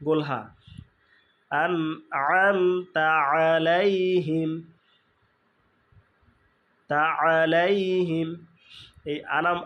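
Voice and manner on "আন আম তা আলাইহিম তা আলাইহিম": "আন আম তা আলাইহিম" slowly, each syllable held; slow tajweed demonstration. Shown as a demonstration. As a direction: squeeze the throat for the ain in "আম" and "আলাইহিম". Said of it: "কণ্ঠনালীকে চেপে". ("আন আম তা আলাইহিম" ধীরে, আইন কণ্ঠ চেপে)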